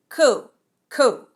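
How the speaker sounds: deadpan, dismissive cool cool; lazy texting guy; bored reply. Retold or imitated. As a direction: shrug it off flatly. Jay's lazy text reply voiced.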